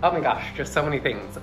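ordinary talk

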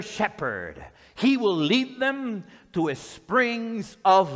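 very slow, swelling pulpit reading; solemn, stretched-out proclamation; scripture reading. Acted. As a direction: draw out each word of the promise, building in weight. Reads scripture aloud to congregation.

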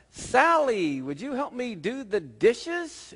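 high, drawn-out grandma call; storyteller's high-pitched grandma. Acted. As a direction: sing out a sweet request, slowly. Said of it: "Grandma says to Sally".

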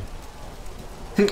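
breathy airy chuckle; quiet laugh under breath; stifled laugh; soft laugh. Real, not acted. Laughing at chat insult.

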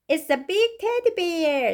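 put-on high, slow child voice; delighted little child's announcement; drawn-out toddler character; story baby.

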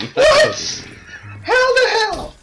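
high startled yelp; shocked yelp. Real, not acted. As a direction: cry out in disbelief. Startled by sudden death.

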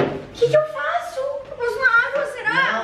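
high, frantic pleading for help; faking panic so her friend falls for the burn prank; mock panic. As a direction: play the helpless burn victim.

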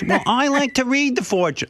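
mother insisting she likes fortunes, high mock voice; imitating her mother. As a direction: pipe up like mom defending her habit. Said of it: "my mother goes".

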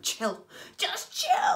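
acted telling-off, leaping high and loud on the repeat; mock exasperated yell, building; exasperated retort. Friend tells panicking friend to calm down, exasperated.

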